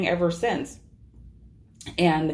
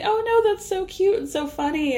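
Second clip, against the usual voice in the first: cooing mock; 12 semitones higher than usual. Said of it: "they're like".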